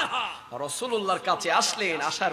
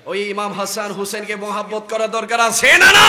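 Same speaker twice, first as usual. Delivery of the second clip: loud question swelling to a long cry; rousing shout; 8 dB louder than usual. Real, not acted. Preacher asks listeners to affirm love, rousing shout. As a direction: whip up the crowd.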